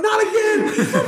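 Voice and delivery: high, slow, laughing outcry; laughing disbelief. Retold or imitated. Bob invited another family unknowingly, slow laughing outcry. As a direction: cry it out through laughter. Bob stunned a second time.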